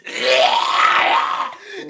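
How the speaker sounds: high, loud wordless scream; crazed guttural scream. Retold or imitated. Homeless man scaring off trespassing kids, crazed scream. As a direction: let out a crazed, drawn-out scream. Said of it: "like, the craziest fucking yell".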